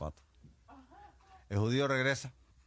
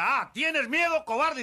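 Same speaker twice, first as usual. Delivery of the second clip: very high, loud, slow jeering; mocking taunt; 10 dB louder, slower than usual. General jeers at the soldier for being scared: slow high taunt. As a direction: sneer at him, drag it out.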